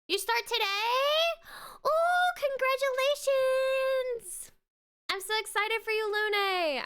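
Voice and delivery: thrilled for a friend starting work today; excited congratulations. Real, not acted. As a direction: burst out with delighted surprise. Hears friend's new job news.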